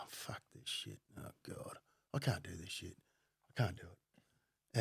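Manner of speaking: low, hushed cursing to himself; muttered dismay. Retold or imitated. New phone worker cursing his bullshit job, muttered dismay. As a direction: mutter it under your breath, very soft.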